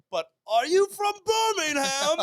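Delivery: high, loud, slow mock British drawl; imitating a drunk British question. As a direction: ham up the drunk Brit's question. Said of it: "then he's like".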